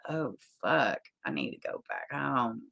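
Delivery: low, very quiet muttered aside; retold dread, soft and low; hushed retreat. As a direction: shrink down to a timid mumble. Retelling his frightened reaction to yelling.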